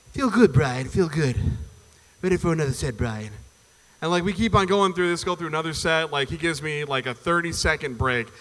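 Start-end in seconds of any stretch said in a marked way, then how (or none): 0.1-3.3 exhausted Stewie. Pretend. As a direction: drag the words out like a spent little Stewie. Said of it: "some exhausted Stewie".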